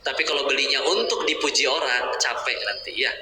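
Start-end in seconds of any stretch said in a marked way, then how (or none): none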